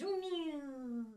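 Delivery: soft, tiny cat mew; kitten mew. Acted. Speaker imitates a kitten.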